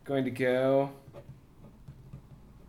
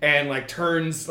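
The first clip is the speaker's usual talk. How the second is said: ordinary talk, nothing marked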